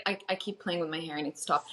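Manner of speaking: ordinary talk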